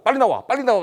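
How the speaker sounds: high, loud retold hurrying; urgent command. Man ordering the official out, urgent and loud. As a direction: call out insistently, imitating the man.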